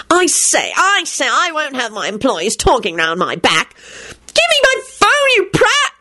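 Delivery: high, slow indignant bluster; pompous boss. A narrator's reading. Percy scuffling for phone.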